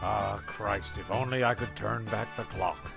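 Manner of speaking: ordinary talk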